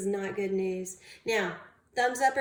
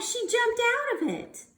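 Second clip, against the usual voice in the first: ordinary delivery, nothing marked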